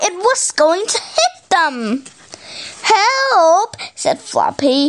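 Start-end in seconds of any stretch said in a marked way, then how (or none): 2.8-3.8 high acted call for help; cry for help. A narrator's reading. Floppy sees a fireball coming, high alarmed cry. Fireball about to hit them.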